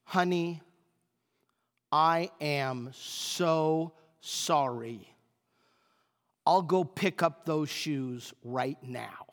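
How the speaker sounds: soft, low, slow apology fading to a whisper; gentle apology. Retold or imitated. Wife answering her husband's insult, soft tender apology. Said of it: "she says to me".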